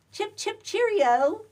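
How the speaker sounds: high, singsong mock-British call; playful put-on British chirp, swelling on the last word. Kidding around about visiting across the pond, mock British.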